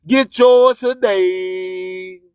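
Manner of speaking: slow, low processed promo line; deep announcer drop; ad tag voice. Acted.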